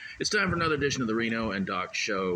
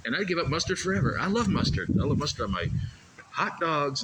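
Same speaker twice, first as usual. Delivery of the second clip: excited; 9 semitones higher, 4 dB louder than usual. Real, not acted. Excited rant about mustard.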